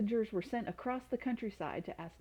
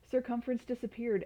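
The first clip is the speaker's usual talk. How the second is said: plain reading voice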